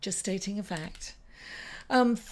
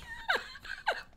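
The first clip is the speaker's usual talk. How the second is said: high squeaky laugh; 6 dB quieter than usual. Real, not acted. Laughs after asking kindness.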